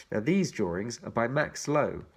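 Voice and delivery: usual speaking voice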